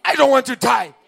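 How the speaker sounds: preacher roaring the refrain to the crowd; shouted refrain. Real, not acted. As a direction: hurl the line at the congregation. Repeated refrain, now shouted.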